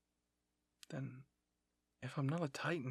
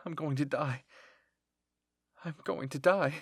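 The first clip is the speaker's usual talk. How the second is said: rising, louder dread; panicked; 9 dB louder than usual. Acted. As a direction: dread building to a loud cry. Narrator's doomed panic as enemies approach.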